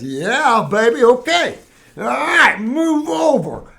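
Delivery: put-on high, loud, playful yeah baby, move over slowly; body's voice. Body answering the healthy food, playful high mock voice. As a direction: ham up the body taking charge. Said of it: "the chemistry that says".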